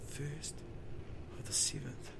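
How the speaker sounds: soft, breathy storytelling whisper; hushed narration. A narrator's reading. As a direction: draw the listener in quietly. Hushed narration of strange place.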